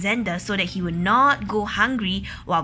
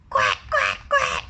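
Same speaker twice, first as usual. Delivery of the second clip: duck quacks; 12 semitones lower than usual. Acted. Ducks quacking.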